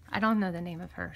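ordinary talk, nothing marked

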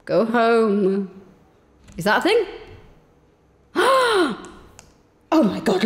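normal talking voice

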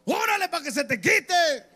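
high, drawn-out macho yell; grito bravucón. Acted. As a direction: shout it slowly, high, like you rule the house. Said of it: "usted grite". (grito agudo y alargado de gallo bravo)

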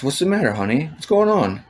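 high-pitched worried questioning, retold; retold concern. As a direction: act out asking a loved one what's wrong. Retold asking his crying wife.

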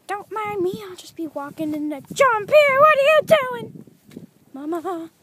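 high goofy put-on voice; silly voice.

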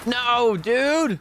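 high, drawn-out protest; dismayed cry. Real, not acted. Gamer protesting a bad play, high dismayed cry.

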